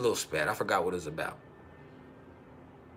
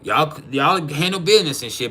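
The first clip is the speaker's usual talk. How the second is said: normal talking voice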